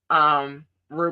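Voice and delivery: ordinary talk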